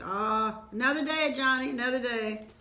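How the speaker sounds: put-on high, loud brush-off to a child; dismissive wave-off. A narrator's reading. Mother waving off Johnny's complaint, high and dismissive.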